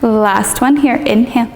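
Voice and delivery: calm yoga teacher leading a meditation; guided meditation. Acted. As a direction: ease the listener down through the body, unhurried. Leads a guided body-scan meditation.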